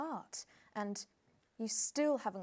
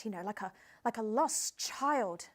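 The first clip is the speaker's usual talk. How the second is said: normal talking voice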